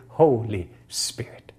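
slow breathy whisper of the name; whispered prayer. Acted. Pastor whispering an invocation to the Holy Spirit. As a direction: whisper it reverently.

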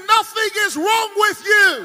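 high, very loud declaration; full-force preaching shout. Real, not acted. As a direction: shout the verdict over the whole crowd. Preacher repeats verdict to crowd.

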